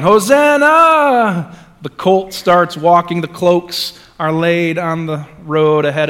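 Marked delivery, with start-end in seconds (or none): 0.0-1.6 high, loud, drawn-out Hosanna; shouting. Retold or imitated. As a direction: cheer it like the welcoming crowd. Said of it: "The crowd start shouting".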